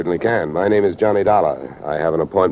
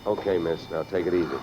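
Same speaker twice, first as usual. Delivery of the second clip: soothing; 8 dB quieter than usual. Acted. Calming shaken secretary.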